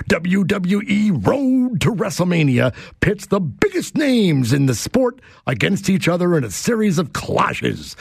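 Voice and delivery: big booming hype-man read; wrestling announcer voice. Shown as a demonstration. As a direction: sell it like ringside on fight night. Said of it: "read this in my wrestling announcer voice".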